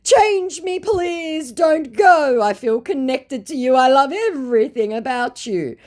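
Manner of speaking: whimpering plea to stay; desperate scammer. Retold or imitated. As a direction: scammer begging her not to leave, very high. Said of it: "To which he responded".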